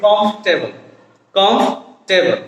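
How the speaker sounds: কমফোর্টেবল squeezed to "komf-tebol"; correct pronunciation. Shown as a demonstration. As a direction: drop the "or" in কমফোর্টেবল, komf-te-bol. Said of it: "উচ্চারণটা হবে কি". (শুদ্ধ উচ্চারণে কমফোর্টেবল, "কমফ-টেবল")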